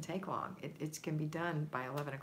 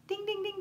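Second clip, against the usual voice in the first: bell chime. Pretend. Host chimes a bell for delegating.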